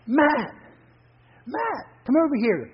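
high, loud call across the water; yelling to Matt. Acted. Drifter yells from the bank to his friend Matt, calling out loud. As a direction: shout to get his friend's attention. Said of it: "yelled out to Matt".

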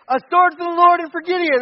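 loud, high rallying cry; mock battle cry. Preacher voicing Gideon's men at night, loud battle cry. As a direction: call it out like troops attacking. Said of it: "and then they say".

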